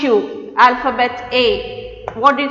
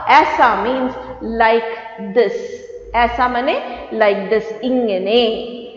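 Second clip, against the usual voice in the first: said very slowly and deliberately; slow gloss; much slower than usual. Shown as a demonstration. Teacher slowly explaining a word's meaning to children. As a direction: drag it out so kids follow. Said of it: "slow gloss".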